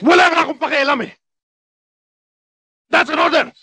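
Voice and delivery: high-pitched shouted order; angry shout. Acted. Officer refusing a bereaved soldier leave, angry shout. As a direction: shout him down and pull rank. Superior pulls rank on subordinate.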